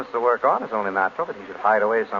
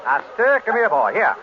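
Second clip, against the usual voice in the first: raised voice calling a dog over; 5 semitones higher, 4 dB louder, slower than usual. Acted. Husband calling his dog in a hotel lobby, loud and firm. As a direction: call the dog sharply to come.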